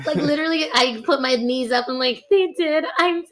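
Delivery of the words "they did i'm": high, pleading mock-guilty apology. Worker confessing to manager she is leaving, sheepish. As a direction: squeeze out a high, sheepish sorry.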